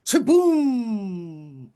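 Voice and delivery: loud chaboom splash; splash sound effect. Acted. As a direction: make the big splash noise.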